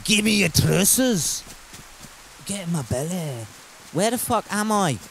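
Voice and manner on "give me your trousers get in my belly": hammed-up Scottish demand, easing off; Scottish accent. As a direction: put on a broad comic Scottish accent. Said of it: "tap into my Scottish accent".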